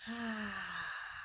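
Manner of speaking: long audible out-breath; slow breathing out. Shown as a demonstration. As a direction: sigh it out slowly. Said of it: "One more butterfly breath".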